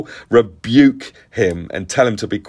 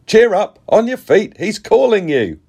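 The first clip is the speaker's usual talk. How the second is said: high, loud, urging call; 12 semitones higher, 4 dB louder than usual. A narrator's reading. Crowd to the blind man, calling out encouragement. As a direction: call across to rouse someone. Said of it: "they called to the blind man".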